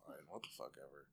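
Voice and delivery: mock sulky mumble; grumbling retreat. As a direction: mumble as you back off. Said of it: "they're like".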